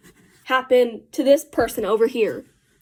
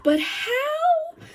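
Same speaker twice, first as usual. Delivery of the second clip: high-pitched squeaky muttering to yourself; retold panicked self-talk, pitched way up; mock mumbling to self; 14 semitones higher, slower than usual. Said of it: "mumbling to myself, like, under my breath".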